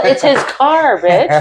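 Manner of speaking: high mocking retort. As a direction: imitate the PO snapping. PO snaps back.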